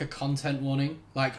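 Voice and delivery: ordinary delivery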